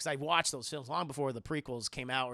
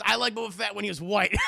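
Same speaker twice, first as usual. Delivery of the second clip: louder, higher mock tabloid quote; mock headline quote; 4 semitones higher, 7 dB louder than usual. Joking about a fake TMZ headline, mock shouted. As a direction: blurt it like a fake gossip headline. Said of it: "TMZ headline, [name] says".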